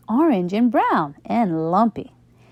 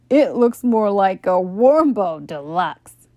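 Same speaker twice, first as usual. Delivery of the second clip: teasing; 4 dB louder than usual. A narrator's reading. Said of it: "teased Buster".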